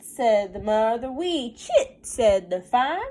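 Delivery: put-on sing-song read-aloud for a child; lilting picture book reading; story narration. A narrator's reading. Mother reading a counting book to her child, sing-song.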